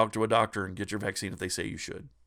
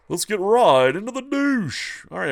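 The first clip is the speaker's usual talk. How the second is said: mock announcer; 8 semitones higher, 8 dB louder than usual. Jokey show segue.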